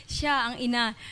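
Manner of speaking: said through real laughter; laughing. Real, not acted. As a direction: laugh as you say it. Laughing while quoting herself.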